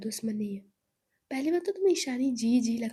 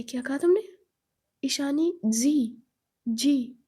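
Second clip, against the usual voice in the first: indignant; 2 semitones higher, 7 dB louder, slower than usual. Acted. Zoya outraged at "ji.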